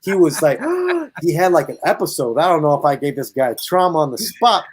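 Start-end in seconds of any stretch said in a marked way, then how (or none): 0.6-1.2 sharp high gasp; shocked gasp. Retold or imitated. Narrator imitates terrified friend, sharp high gasp. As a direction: suck in air in horror.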